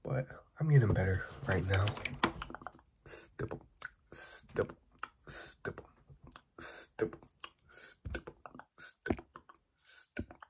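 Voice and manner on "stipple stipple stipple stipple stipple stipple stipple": rapid whispered trigger words; fast aggressive asmr. Acted. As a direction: spit out each word in a fast, crisp whisper.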